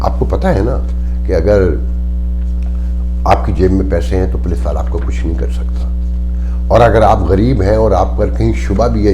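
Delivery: impassioned outcry. Real, not acted. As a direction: hammer every line like a protest speech. Impassioned tirade against the state. (پرجوش بلند آواز میں تقریر)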